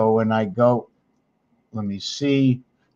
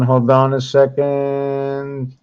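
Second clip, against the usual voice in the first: slow drawn-out hold on; much slower than usual. Real, not acted. Teacher stalling at the screen, slow and drawn out. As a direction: stretch out Hold on a second slowly. Repeats phrase while searching.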